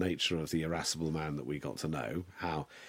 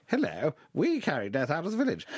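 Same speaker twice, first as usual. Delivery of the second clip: high, hammed-up posh southern English voice; mock a silly posh southern English child, pitched way up; plummy southern English, squeaky and absurd; idiotic posh southern English; 9 semitones higher, 4 dB louder than usual. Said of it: "this idiotic, posh southern voice".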